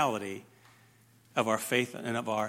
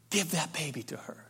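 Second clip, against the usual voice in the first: softer, calm echo of the plea; quiet king's verdict; 5 dB quieter than usual. Retold or imitated. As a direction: Solomon softly repeats the mother's words. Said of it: "Solomon says".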